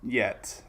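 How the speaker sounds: a lone soft yet after a long pause; stress on yet. Shown as a demonstration. Vlogger repeating yet alone for emphasis, quiet and deliberate. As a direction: let yet hang on its own, quiet and pointed. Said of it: "I wanna stress the word yet".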